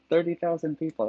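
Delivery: high, loud disbelief; incredulous. Real, not acted. Streamer from a tiny town scoffs at the book's "tiny" station, incredulous.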